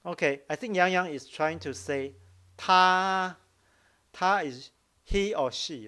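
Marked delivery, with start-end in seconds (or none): 2.6-3.4 "ta" high, level and held; sing-out "ta" on one high, loud note; first tone. Shown as a demonstration. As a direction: stretch "ta" long on a steady high pitch. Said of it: "So it's the first tone".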